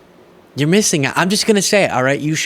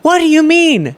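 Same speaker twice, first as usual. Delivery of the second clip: shrill shocked what do you mean, much higher and louder; incredulous what; 14 semitones higher, 5 dB louder than usual. Real, not acted. Host reacting to Kari Lake clip, incredulous and loud. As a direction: blurt it out in disbelief. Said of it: "host reacts in disbelief".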